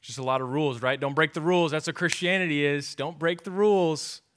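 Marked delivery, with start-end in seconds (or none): 1.0-4.2 louder, higher stern parroting; mock rule enforcer. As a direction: bark the rule like a strict parent.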